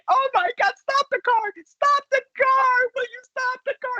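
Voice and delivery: very high, wailing plea; crying mother. Retold or imitated. Mother begging father to stop driving, tearful high wail. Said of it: "starts crying and going".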